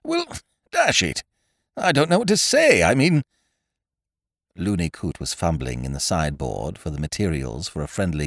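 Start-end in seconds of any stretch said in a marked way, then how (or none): 0.0-3.2 halting, broken, high-pitched fluster; flustered stop-and-start apology; stammering. A narrator's reading. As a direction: stumble for words with pauses. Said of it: "he stammered".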